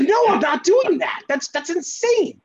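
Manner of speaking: high-pitched, exasperated refusal; incredulous refusal. Retold or imitated. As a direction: refuse in disbelief, voice jumping way up. Acts out refusing God's order.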